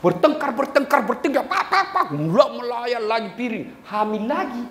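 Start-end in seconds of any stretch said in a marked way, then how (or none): none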